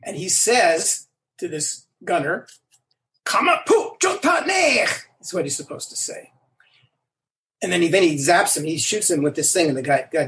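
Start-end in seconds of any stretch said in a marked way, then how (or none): 3.2-5.0 high, drawn-out angry Klingon line; slow, high-pitched Klingon outburst; angry Klingon shout. Acted. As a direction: yell it as a furious Klingon commander. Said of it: "and Krug is angry".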